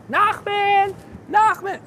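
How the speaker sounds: loud call across the playground. Acted. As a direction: call his name out loud. Calling for the lost puppet.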